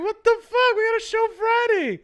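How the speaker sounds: high, loud, disbelieving yelp; stunned outrage. Retold or imitated. Retelling his shock at the boss's safari note, high incredulous yell.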